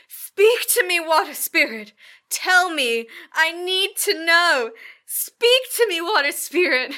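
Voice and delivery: loud, high, drawn-out mock summoning; hammed-up medium. Co-host retelling the medium calling the water spirit, hammed-up summoning. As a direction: implore the spirit like a hammy medium.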